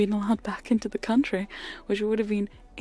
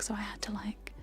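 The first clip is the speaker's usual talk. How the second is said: very soft close whisper; ASMR whisper; 10 dB quieter than usual. Acted. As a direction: whisper the story into the mic.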